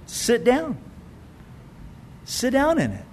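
just say it simply and softly; showing that quiet faith just sits down. Shown as a demonstration. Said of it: "strong faith that's the quietest".

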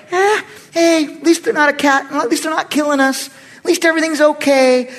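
high, whiny shrug of a voice, all is fine; complacent Saul. Retold or imitated. Preacher voicing Saul under the tree, content and unbothered. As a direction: brush it all off, pleased with the status quo.

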